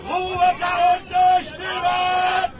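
slow, loud bellow downward; loud shout for silence. Acted. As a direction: roar the order down the ship. Captain yelling for silence.

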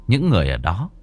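usual speaking voice

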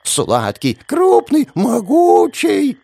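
high, slow, squealing nymph voice; squealing mavki. A narrator's reading. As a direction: squeal it with hungry delight. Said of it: "стали визжать наперебой".

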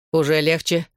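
usual speaking voice

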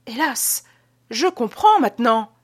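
lamentation théâtrale, haut perchée; gémissement. Acted. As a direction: se plaindre d'une voix qui monte et enfle. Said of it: "continua-t-il à gémir". (« hélas » d'homme accablé, déclamé)

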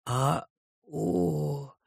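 soft theatrical groan; mock groan. A narrator's reading. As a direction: let out a weak, exaggerated moan. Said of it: "простонал Джосс". (слабый притворный стон)

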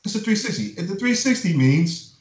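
high-pitched mock panic; mock alarm. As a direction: mimic everybody's horrified reaction. Mocking everyone's alarm at the deal.

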